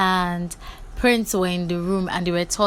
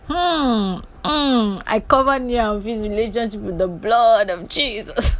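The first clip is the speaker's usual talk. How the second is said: mock prayer; 2 semitones higher than usual. Mock prayer over couple.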